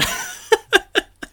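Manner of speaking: squeaky high-pitched giggle. Real, not acted. Laughs at own joke.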